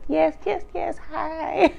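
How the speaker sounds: high-pitched gushing mimicry; mock niceness. Wendy trying to still be good, mock niceness. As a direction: imitate an overdone, high hello.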